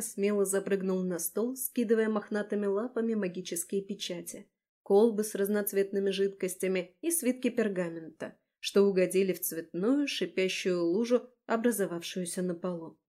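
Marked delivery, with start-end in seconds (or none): none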